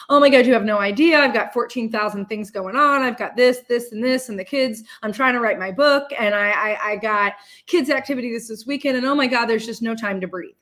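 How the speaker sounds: loud, fast, overwhelmed mock complaint; hammed-up complaining. Friend Neil complaining about how busy he is, hammed-up and loud. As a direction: pile it on like a swamped friend. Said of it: "I'm just gonna use him as an example".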